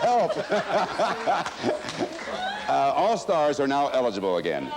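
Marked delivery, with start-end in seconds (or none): 0.4-2.6 big burst of laughter. Real, not acted.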